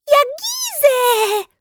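put-on very high, slow toddler babble; high drawn-out baby word; baby exclaiming. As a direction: shout it like a proud toddler. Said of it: "wykrzyknęła Bella".

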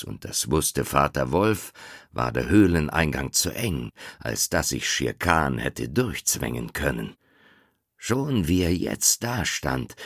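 ordinary delivery

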